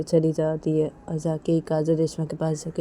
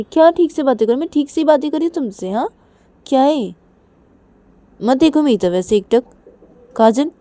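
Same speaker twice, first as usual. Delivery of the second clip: higher, louder, fast retort; higher quick exasperated reply; irritated Reshma; 9 semitones higher, 9 dB louder than usual. Acted. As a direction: bite back quickly.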